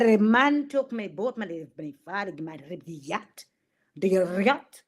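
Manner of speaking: mock Russian accent, rolled Rs. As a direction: put on a thick Russian voice. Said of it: "[name] in her Russian voice".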